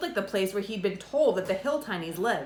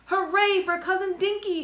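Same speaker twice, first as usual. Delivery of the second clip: shouted; 11 semitones higher than usual. A narrator's reading. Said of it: "shouted Tip".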